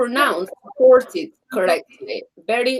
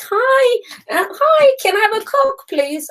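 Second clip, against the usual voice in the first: nervous foreigner at the bar, asking high; high timid ordering voice; 12 semitones higher, 4 dB louder than usual. Retold or imitated. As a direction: voice pitched way up, politely asking. Retelling self ordering at bar.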